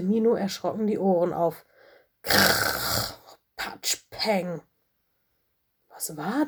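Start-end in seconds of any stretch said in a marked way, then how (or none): 2.2-4.6 Geräuschnachahmung. A narrator's reading. Geräusche aus dem Haus.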